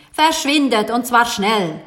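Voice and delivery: loud, brusque order; unfriendly dismissal. A narrator's reading. Baker chasing the children out of his shop, unfriendly. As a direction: send them packing, loudly and crossly. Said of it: "brummte der Bäcker unfreundlich".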